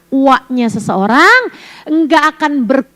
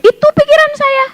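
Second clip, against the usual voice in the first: mock inner voice; 12 semitones higher than usual. Preacher voicing own inner thought.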